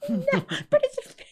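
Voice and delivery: very high, loud mock squeal; imitating screaming mom. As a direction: shriek it like an overexcited mom. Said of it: "She'd, like, scream".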